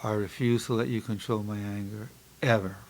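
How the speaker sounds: low, soft affirmation after deep breaths; relaxed self-talk. Shown as a demonstration. Psychologist guiding listeners through breathing mantra, calm and low. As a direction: lead the exercise calmly and quietly. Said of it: "I'm taking deep breaths, relaxing".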